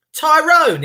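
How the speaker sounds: a high, startled check of the name; surprised recognition. Retold or imitated. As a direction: suddenly realise who he means. Realising he knows the man.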